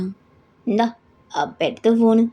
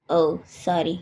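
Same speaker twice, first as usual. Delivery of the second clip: ordinary delivery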